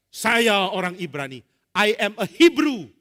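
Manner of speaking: loud, slow, proud proclamation; bold declaration. Acted. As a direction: declare it loudly and slowly. Musa declares himself Hebrew. (seruan lantang dan lambat)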